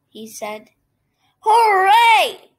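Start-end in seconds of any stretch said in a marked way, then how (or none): 1.4-2.5 loud, high, jubilant shout; whooping crowd hurrah; shouted cheer. A narrator's reading. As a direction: cheer at the top of your voice. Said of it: "shouted the people".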